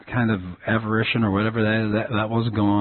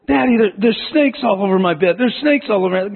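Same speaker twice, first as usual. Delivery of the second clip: higher voice, a touch of mock panic; frightened child; 8 semitones higher than usual. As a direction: play the scared little girl lightly. Small daughter screaming about snakes.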